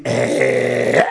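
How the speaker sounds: drawn-out low startled "Eh?"; woman stunned by the news; astonished eh. Acted. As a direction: react in disbelief.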